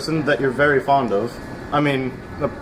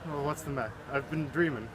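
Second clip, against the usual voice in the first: quiet; 12 dB quieter than usual. Acted. Waking from a daze.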